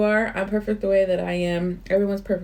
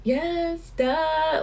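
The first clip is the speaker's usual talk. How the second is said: much higher, sing-song mock answer to herself; playful self-talk; 12 semitones higher, much slower than usual. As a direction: tease yourself with an obvious yes. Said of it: "I always stop and I talk like".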